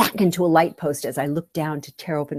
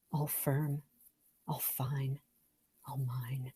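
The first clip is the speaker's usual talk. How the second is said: whisper; 3 semitones lower, 14 dB quieter, slower than usual. Acted. Savoring candy, whispered.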